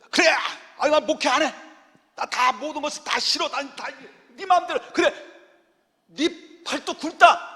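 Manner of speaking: high, exasperated mock rant, slowing at the end; fed-up Moses. Moses quitting on the people, mock exasperated. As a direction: play Moses throwing up his hands. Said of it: "모세 입장에 볼 땐".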